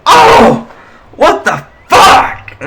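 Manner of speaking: high, loud shocked yell; pained outburst. Acted. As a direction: cry out as the bottle hits. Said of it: "he lets it out".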